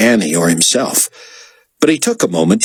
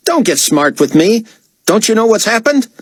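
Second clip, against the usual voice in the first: high, scolding diva voice; indignant artiste; 14 semitones higher than usual. A narrator's reading. Artiste telling off a flippant David, indignant. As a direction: scold him in a high, outraged voice.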